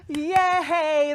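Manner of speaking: high, loud cheer of delight; delighted yay. Real, not acted. Host cheering the staff thank-you video, delighted. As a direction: let out a happy cry.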